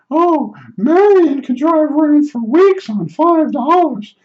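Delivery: high falsetto, slow and prim; neighbor woman voice. Retold or imitated. Neighbor bragging about Marion's cheap driving, slow high falsetto. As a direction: drawl it like a stingy prim old lady. Neighbor comparing gas money.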